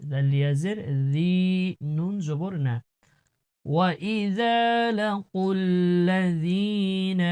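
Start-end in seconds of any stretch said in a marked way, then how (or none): 3.7-7.2 slow, drawn-out tilawat chant; Quran recitation. Acted. Teacher recites the verse for students, melodic tilawat. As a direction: recite melodically, holding each vowel.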